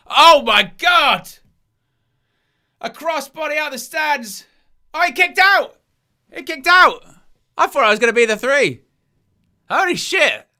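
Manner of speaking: screaming high, huge excitement; erupting over a huge dive; wrestling commentator. Real, not acted. Commentator erupts at big spot.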